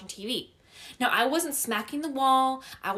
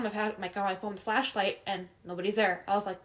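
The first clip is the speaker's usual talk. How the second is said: ordinary talk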